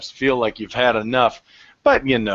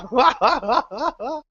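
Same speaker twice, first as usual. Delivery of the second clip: high hearty laugh; 12 semitones higher than usual. Acted. Patron bursts out laughing.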